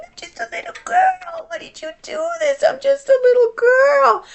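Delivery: put-on very high, breaking cry of a small child; sobbing little girl. Little girl left alone in class, crying out in a very high sob. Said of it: "my body was just going".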